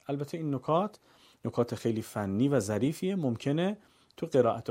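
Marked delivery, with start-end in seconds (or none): none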